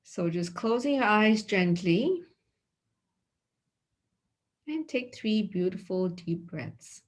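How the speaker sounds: soft, unhurried meditation voice with long pauses; guided meditation. Acted. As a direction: guide them quietly into the body scan. Leads a body scan meditation.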